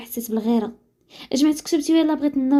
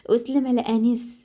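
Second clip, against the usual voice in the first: usual speaking voice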